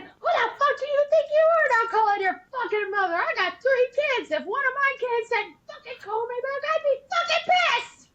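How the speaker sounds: high and loud, near-screaming tirade; furious rant. Retold or imitated. Mother of three ranting at the soldier on the phone, furious. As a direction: tear into him like an outraged mom. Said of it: "me being ten times louder than Joey".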